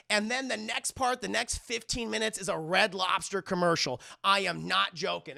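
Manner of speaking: ordinary talk, nothing marked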